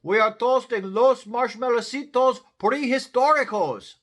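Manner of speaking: high, loud little-dog holler; hollering Chihuahuas. A narrator's reading. Chihuahuas call down to Skipito, hollering. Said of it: "hollered the Chihuahuas".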